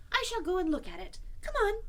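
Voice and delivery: put-on high, brisk boyish voice; resolute Christopher Robin. Christopher Robin putting on his shoes, high and decided.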